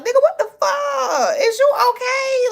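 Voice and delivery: high-pitched, exasperated disbelief; incredulous. Retold or imitated. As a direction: question him like he has lost it. Mock reaction to an angry man.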